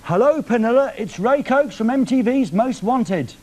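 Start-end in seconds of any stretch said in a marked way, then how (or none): none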